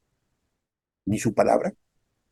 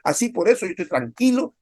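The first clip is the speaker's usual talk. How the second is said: smug unbeliever; 7 semitones higher than usual. Retold or imitated.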